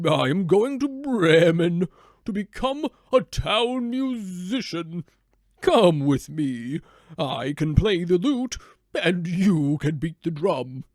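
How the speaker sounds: put-on slow, hearty old donkey inviting; a cheerful old donkey talking a friend into an adventure. Donkey invites the dog to Bremen to be musicians, slow and hearty.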